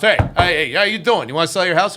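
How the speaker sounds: mock tough guy at the door; tough Marine guy. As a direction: bark it like a cocky Marine. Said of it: "tough Marine guy".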